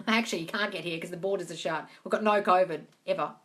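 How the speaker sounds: ordinary talk, nothing marked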